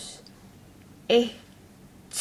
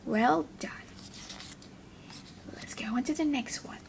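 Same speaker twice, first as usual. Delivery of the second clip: soft unvoiced whisper, quick; 8 dB quieter, much faster than usual. Teacher whispers praise to children, quickly and softly.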